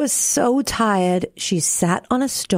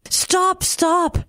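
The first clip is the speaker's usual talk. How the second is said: high, urgent cry of alarm; excited; 11 semitones higher than usual. A narrator's reading. Frog hopping about as Tearful cries, high excited plea. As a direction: pitch it right up, urging a halt. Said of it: "hopping about excitedly".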